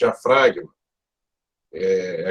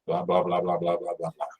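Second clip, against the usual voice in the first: fading blá demo; 3 semitones lower, 7 dB quieter than usual. Shown as a demonstration. Teacher showing students speech on outgoing breath, fading softer. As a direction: babble fading softer as the air runs out. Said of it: "vai soltando e vai falando".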